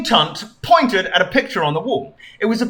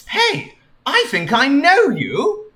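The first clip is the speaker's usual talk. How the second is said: lightly voiced Sonic; 9 semitones higher, slower than usual.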